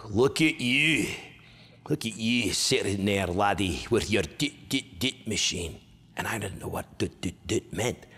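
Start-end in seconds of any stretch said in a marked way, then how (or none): 0.1-5.8 slow, scornful Scottish put-on voice; disgusted Scottish stepfather. Imitating a stepfather eyeing a young gamer, slow Scottish disgust. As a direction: sneer it slowly in a broad Scots accent. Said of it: "look at me with disgust".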